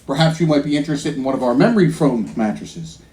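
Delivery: low-pitched mock salesman pitch; formal sales patter, dropped low; mattress salesman. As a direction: imitate an over-polite salesman. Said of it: "The salesman says to me".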